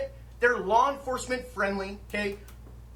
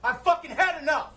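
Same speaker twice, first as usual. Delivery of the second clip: loud, higher angry outburst; furious shout; 6 semitones higher, 4 dB louder than usual. Real, not acted. Real fury. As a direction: shop owner venting at police, shouting in real fury. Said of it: "I'm fucking pissed".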